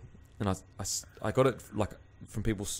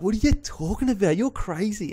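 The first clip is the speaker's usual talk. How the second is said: much higher, disbelieving protest; mock-outraged high-pitched retort; incredulous retelling; 12 semitones higher, 3 dB louder than usual. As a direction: act out total bewilderment, pitch jumping up. Retelling his incredulous reply to ex.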